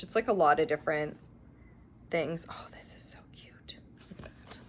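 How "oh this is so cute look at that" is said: voiceless delighted whisper; soft breathy whisper of delight. Real, not acted. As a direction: hush it, as if not to disturb. Whispering over magazine page.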